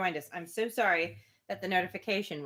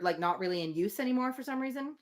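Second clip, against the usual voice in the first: ordinary talk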